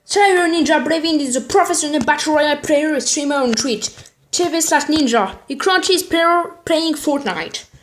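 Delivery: French speaker's put-on English accent; attempted English accent. Reading a streamer's bio aloud, hammed-up English accent. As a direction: read it in a labored English accent, dragging the end. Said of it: "mon accent anglais is very, very good".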